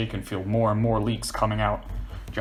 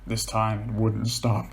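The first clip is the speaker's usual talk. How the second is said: ordinary delivery, nothing marked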